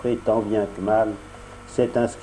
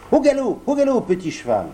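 high, bright rush, faster on the second half; joyful cry; 9 semitones higher, faster than usual. A narrator's reading. Pinocchio on the pigeon's back, happy shout. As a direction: cheer on your mount. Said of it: "s'écria tout heureux".

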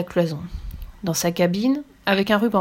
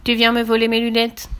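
understated narrated cry of accusation; 3 semitones higher, 4 dB louder than usual. A narrator's reading. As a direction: cry out accusingly, only moderately raised. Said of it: "se mit à crier".

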